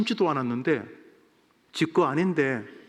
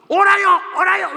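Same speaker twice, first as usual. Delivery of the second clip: high, loud defiant cry; shouting; 11 dB louder than usual. Retold or imitated. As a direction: yell back at God, stubbornly insisting. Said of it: "외칩니다".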